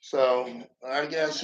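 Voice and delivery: ordinary talk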